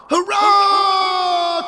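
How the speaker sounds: high, loud, long-held cheer; jubilant cry. A narrator's reading. Algy cheers as Fiddly grows, jubilant cry. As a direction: whoop it out in triumph. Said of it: "cried Algy".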